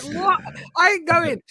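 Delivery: very high, loud incredulous outburst; mock-shocked refusal. Retelling his refusal to hike among killer animals, mock shock. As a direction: squeal it in comic disbelief.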